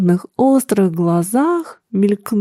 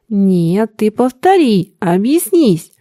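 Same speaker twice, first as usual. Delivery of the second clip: usual speaking voice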